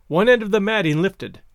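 plain reading voice

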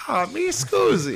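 high, drawn-out mock-Italian film quote; movie Italian. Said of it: "Euro Trip".

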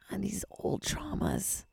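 soft breathy whisper. Real, not acted. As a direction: whisper it like a secret. Drops to a whisper.

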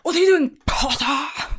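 hammed-up jeer at a classmate; mock playground taunt, pitched high; Draco Malfoy. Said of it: "It's like Draco fucking Malfoy".

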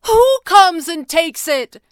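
high, loud, drawn-out demand. A narrator's reading. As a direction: shout the question in outrage. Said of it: "Jeremy was getting louder".

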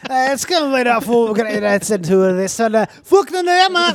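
loud, high, garbled mock Scottish; wildly exaggerated Scottish accent; insane Scottish accent. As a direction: slur out incomprehensible Scottish gibberish. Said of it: "Imagine ordering bagels in Scotland".

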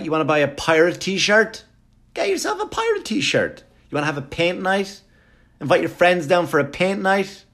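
high squeaky falsetto pitch; hammed-up town booster. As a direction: coax them along in a silly high voice. Mock sales pitch for Port Jeff.

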